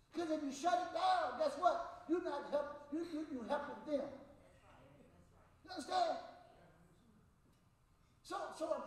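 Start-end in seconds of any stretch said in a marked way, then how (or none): none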